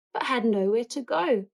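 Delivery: ordinary delivery, nothing marked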